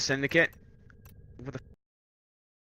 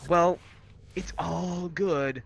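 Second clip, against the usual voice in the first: higher, drawn-out mock reply; mock objection; 8 semitones higher, slower than usual. Reviewer acting out his answer to all the praise, mock objection. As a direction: stretch it out high, acting out the objection. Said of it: "not sit here and go".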